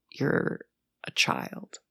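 very soft, nearly voiceless put-down; quiet contempt. Acted. To someone being shitty in customer service, quietly dismissive. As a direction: let it drop low and hushed, dismissive.

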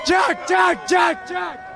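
high shouted crowd chant; rowdy jeering pub banter. Real, not acted. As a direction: chant his name like a rowdy fan.

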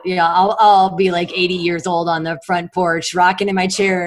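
ordinary talk, nothing marked

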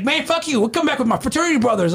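high, fast indignant comeback; mock angry threat. Guest threatening the doorman with backup, high mock outrage.

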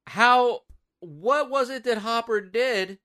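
high, loud disbelief; incredulous. Real, not acted. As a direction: pitch rising in baffled disbelief. Baffled by ankle shackle plot.

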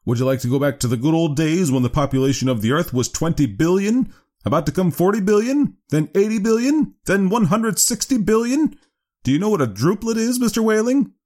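high, insistent lecturing doctor; severe doctor. Acted. Doctor challenging father on population control, severe and rising.

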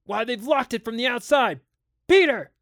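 high-pitched alarm building to a loud yell; alarmed shout. A narrator's reading. Father locked in by his children, alarmed. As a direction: realise in alarm, then yell the name.

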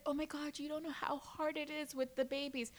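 soft, put-upon mock whine; mimicked complaining. Younger mom lamenting to older moms, mock plaintive. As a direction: imitate a self-pitying new mother, softly.

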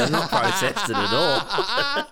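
slow, high, laughing confession; high, drawn-out giggling admission; laughing slowly. Real, not acted. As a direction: let the laugh break through.

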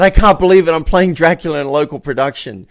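loud, high burst of disbelief, easing slightly; thrilled. Retold or imitated. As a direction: retell it like phoning friends with amazing news. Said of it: "I'm thrilled. I'm beyond thrilled".

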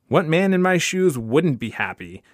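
pointed, ironic rhetorical question; bitter irony. Acted. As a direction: protest with mock cheer. Bitter father answers the doctor.

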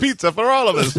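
hammed-up Italian accent; fake Italian accent. Shown as a demonstration. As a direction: play a cartoon Italian host. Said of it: "do the fake Italian voice".

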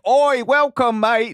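high, hammed-up Cockney greeting; playful mock Cockney; Cockney accent. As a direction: toss it off in a jokey Cockney accent. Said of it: "would come back like".

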